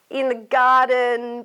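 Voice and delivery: ordinary delivery, nothing marked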